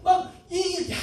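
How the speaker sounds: ordinary delivery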